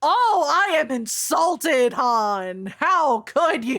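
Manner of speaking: loud, high, drawn-out melodramatic indignation; hammed-up wounded outrage, slow and loud; mock offence. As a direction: play it as a grandly insulted diva. Mock offence at being insulted.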